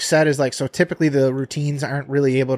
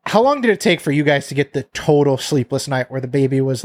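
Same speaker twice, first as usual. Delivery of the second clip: usual speaking voice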